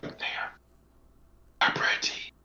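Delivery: whispered imitation of a ghostly voice; real soft. Investigator imitating a soft spirit voice on a recording, whispered. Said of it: "it's real soft".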